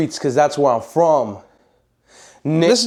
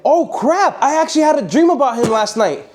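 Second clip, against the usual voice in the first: much higher, startled; shocked realization; 8 semitones higher than usual. Retold or imitated. [name] realizes he dreamed of Carlos, pitched up. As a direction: blurt it out in shock. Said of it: "I was like".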